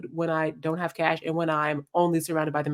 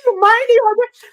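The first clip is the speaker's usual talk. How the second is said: squeaky, high, loud mock outrage; 8 dB louder than usual. Narrator cutting in at the meddling friend in high mock outrage. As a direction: act scandalised on her behalf.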